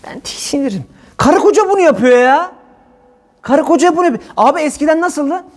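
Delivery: excited rant. Real, not acted. Heated rant about shared plates.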